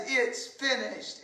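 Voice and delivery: soft, spent final words; quiet. Acted. Jesus at his last breath, soft and spent. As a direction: let it fall away almost unheard. Dying words set against the cry.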